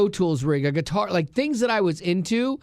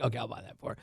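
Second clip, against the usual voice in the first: quiet aside; 8 semitones lower, 13 dB quieter, much faster than usual. Retold or imitated. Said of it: "And so I'm like".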